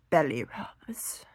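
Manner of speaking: slow whisper; hushed comic punchline. Acted. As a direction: breathe it out slowly.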